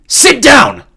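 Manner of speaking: loud, high barked order; shouting. A narrator's reading. Xander shouting Jordan back out of the cockpit, loud. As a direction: command him back, full voice. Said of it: "Xander shouted".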